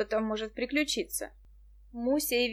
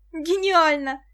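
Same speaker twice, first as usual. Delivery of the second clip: loud, high-pitched cry of admiration; delighted exclamation; 6 semitones higher, 9 dB louder, slower than usual. A narrator's reading. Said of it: "воскликнула Виктоша". (восторженное восклицание)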